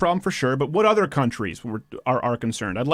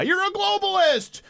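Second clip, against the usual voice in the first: high, slow, hammed-up accusation; mock accusing yell; slower than usual. Mocking people throwing the word.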